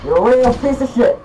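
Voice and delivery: real gamer outburst; angry insult. Real, not acted. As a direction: hurl it at the opponent. Cursing opponent after death.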